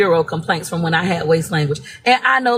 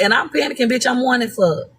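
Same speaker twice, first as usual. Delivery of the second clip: ordinary talk, nothing marked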